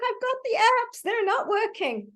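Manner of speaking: high-pitched panicked quote of the frantic mother. Retold or imitated. Therapist imitating a panicked mother in the shelter, high and frantic. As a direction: squeal it like a mum whose apps failed.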